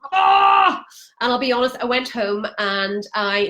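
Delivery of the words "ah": loud, high-pitched "aah!"; high, loud yell for effect; mock scream. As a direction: let out a mock shout of dread. Mock cry at ninety-day decision.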